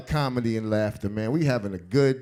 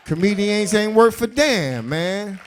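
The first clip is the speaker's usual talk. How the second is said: ordinary delivery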